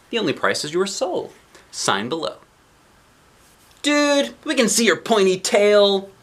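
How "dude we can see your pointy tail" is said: put-on very high, louder teasing child voice; mocking kid. A narrator's reading. Kids mock the devil offering a contract, high teasing voice. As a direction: call out the devil as a cheeky kid.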